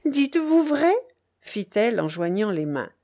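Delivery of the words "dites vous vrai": high, louder, eager question; joyful cry. A narrator's reading. Rochaine hears he does not love her rival, overjoyed. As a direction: burst out with joyful hope. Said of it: "poussa un cri de joie".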